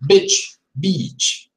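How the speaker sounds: "bitch" short i, then "beach" long ee; short then long vowel. Shown as a demonstration. Teacher contrasting vowels for students. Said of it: "look at my mouth".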